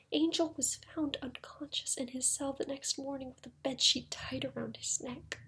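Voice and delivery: soft, subdued telling; grim hush. Acted. Lawyer telling how Angel was found in his cell, hushed. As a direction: drop the volume for grave news. Grim news of suicide attempt.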